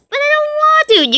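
put-on whiny little kid. Son refusing to tell dad the truth, whiny. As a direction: whine like a scared small child.

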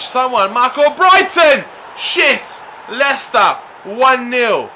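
loud, high dismayed shout; frustrated yell. Real, not acted. Manager watching his team concede, frustrated yell. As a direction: yell as the goal goes in.

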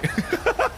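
short, high-pitched laugh; quick high laughter. Acted. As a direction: burst into a high giggle. Laughs after being looked at.

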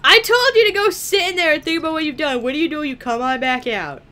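high, loud, fast telling-off that eases off; mock scolding. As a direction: playfully scold a disobedient child. Mock scolding as character returns.

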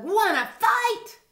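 high, slow squeaky dare; grouchy ladybug. A narrator's reading. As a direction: challenge them in a tiny high voice. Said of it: "said the grouchy ladybug".

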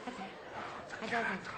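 very soft, low, coaxing; hushed soothing. Acted. Partner saying never mind, sit down, soothing softly. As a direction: quietly settle them, letting it all drop.

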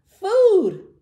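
loud, high repeated yell; big storybook shout, falling away; thundering shout. A narrator's reading. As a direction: thunder it out greedily. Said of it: "thundered Tiny".

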